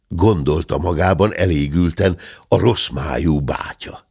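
usual speaking voice

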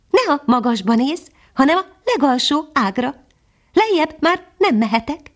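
a little hidden bird answering from the branches; tiny nightingale. Acted. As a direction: speak as a tiny, gentle songbird. Nightingale voiced in story.

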